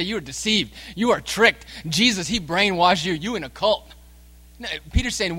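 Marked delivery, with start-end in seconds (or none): 0.0-3.9 higher-pitched mock accusations from doubters; imitating scoffers. People accusing the believer of being fooled, imitated scoffing. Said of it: "people say".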